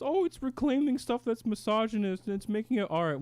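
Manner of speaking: mocking a classmate in a high whiny voice; mocking Ryan. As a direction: sneer at his argument in a high voice. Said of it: "you may have heard Ryan".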